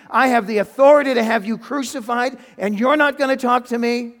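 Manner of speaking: loud, high, incredulous outburst; indignant. Acted. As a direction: pull rank on a silent prisoner. Pilate indignant at Jesus's silence.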